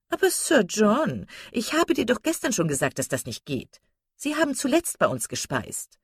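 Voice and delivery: high-pitched, put-upon lady; protesting wife. Acted. Wife reminding Sir John about the Gilberts, high protest.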